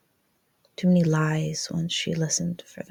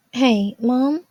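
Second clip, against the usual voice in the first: put-on higher, louder teen greeting; Jazz; 7 semitones higher, 7 dB louder than usual. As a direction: call out to Mom hesitantly.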